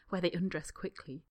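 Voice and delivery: usual speaking voice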